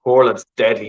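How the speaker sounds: usual speaking voice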